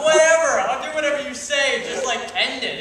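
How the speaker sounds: high, loud, fed-up whine; bored princess cutting her captor short, exasperated and fed up; exasperated brush-off. Retold or imitated. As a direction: wave off the boring lecture, pleading for it to end. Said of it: "And I'm like".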